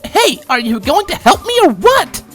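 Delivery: high, loud, impatient unicorn; indignant high-pitched demand. A narrator's reading. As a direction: demand help crossly. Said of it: "the unicorn demanded".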